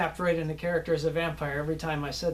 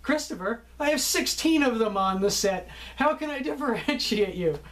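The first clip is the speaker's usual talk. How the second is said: laughing director; 6 semitones higher than usual. Retold or imitated. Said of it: "laugh and look at you and go".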